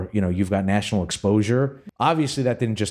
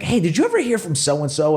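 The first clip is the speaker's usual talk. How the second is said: high-pitched eager question; eager mock inquiry; 9 semitones higher, 3 dB louder than usual. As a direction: pipe up brightly with an acted question. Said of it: "I'll be like".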